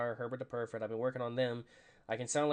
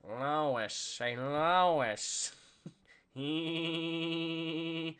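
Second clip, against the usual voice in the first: lightly voiced cartoon dad calling his wife; drawn-out name, then a long held cartoon chuckle; Peter Griffin; 3 semitones higher, much slower than usual. Streamer practicing a requested impression for viewers, light Peter Griffin voice. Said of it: "like, a decent Boston accent while doing it".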